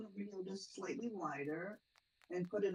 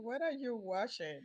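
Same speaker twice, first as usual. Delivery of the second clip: slow, higher, lightly voiced puzzled man; mock confused man; 4 semitones higher, slower than usual. Friend imagining the actor's reaction, lightly voiced baffled man. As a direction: play him baffled. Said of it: "He's gonna be like".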